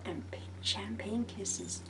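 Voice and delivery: breathy whispered blown kiss. Acted. Whispered sign-off kisses.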